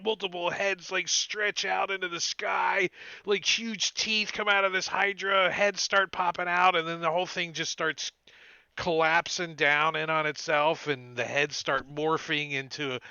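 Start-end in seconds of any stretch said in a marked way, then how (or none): none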